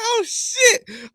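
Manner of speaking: very high squeaky oh shit; startled yelp. Retold or imitated. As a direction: squeal it in fright. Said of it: "So I was like".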